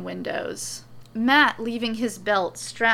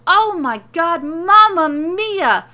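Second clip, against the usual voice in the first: high, loud, agitated protest; frantic yelling; 7 semitones higher, 9 dB louder than usual. A narrator's reading. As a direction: protest in a theatrical panic. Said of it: "Morrow yells".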